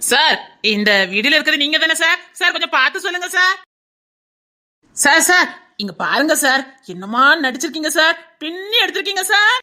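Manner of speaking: mock-respectful, pestering call; mocking taunt. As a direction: tease him with fake admiration, pitch climbing. Heckler mockingly praises man in video.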